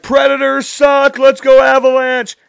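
high, loud heckling fan chant; shouted taunt. Retold or imitated. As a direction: holler it slowly at rival fans. Taunts crowd with team chant.

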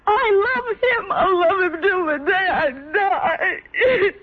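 high, slow, sobbing declaration; weeping. Acted. Heartbroken Anne to Alice, slow high weeping. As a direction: cry it out, dragging every word.

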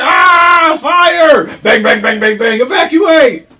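high, full-volume panicked cop impression; screaming in character. Cohost acting out a cop under attack, screaming. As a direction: scream the alarm and gunfire as the cop. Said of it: "Can you stop screaming".

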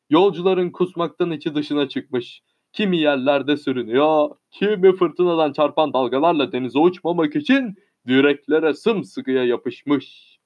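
ordinary delivery

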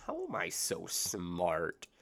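ordinary delivery

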